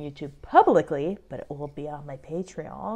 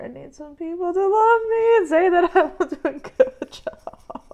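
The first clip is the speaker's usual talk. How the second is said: high, louder, breaking into crying laughter; real tearful laugh; 10 semitones higher, 4 dB louder than usual. Real, not acted. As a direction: voice climbs high as the tears come.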